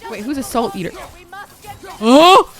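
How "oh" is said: sudden loud high oh; startled oh. Real, not acted. Gamer spots a salt eater, a loud startled oh. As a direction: jolt at the scare. Surprised by game threat.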